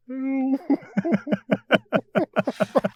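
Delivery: very high helpless giggle. Real, not acted. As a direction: squeal with giggles. Laughing about phone dropping.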